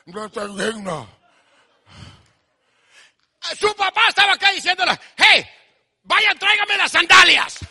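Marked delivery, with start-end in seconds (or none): 0.1-1.2 abject confession. Acted. Son confesses unworthiness to father. 5.2-7.6 loud, commanding call; calling out orders. Acted. Father sending servants for sandals, loud commanding call.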